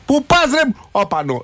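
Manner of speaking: high-pitched mock insult; mock outburst. Radio host acting out someone hurling an insult, high mock indignation. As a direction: launch it high, like a hammed-up road insult. Acts out an abusive driver's outburst.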